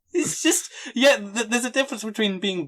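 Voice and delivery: high, breaking with laughter; laughing. Real, not acted. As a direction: laugh while trying to talk. Laughing hard.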